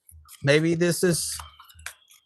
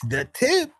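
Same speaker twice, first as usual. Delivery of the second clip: incredulous echo; 8 semitones higher than usual. Real, not acted.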